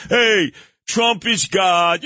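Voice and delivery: slow, mocking fawning host voice; mocking talk host. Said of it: "these talk show hosts".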